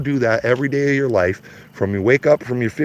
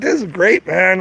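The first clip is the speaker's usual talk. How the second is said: mock glee; 7 semitones higher than usual. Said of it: "I would be like".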